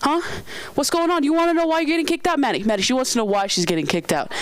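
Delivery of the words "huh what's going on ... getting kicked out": higher-pitched imitation of a fired-up manager; confrontational manager. Manager confronts the angry customer, mock confrontational. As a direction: challenge her, picking up speed at the end.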